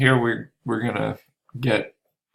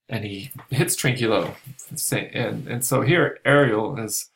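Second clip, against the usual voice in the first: usual speaking voice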